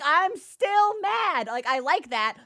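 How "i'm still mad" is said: slow, higher, louder drawn-out pout; stubborn sulky cat. Retold or imitated. Cat refusing snuggles, slow, higher and louder pout. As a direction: drag it out like a cat refusing comfort. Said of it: "He's like".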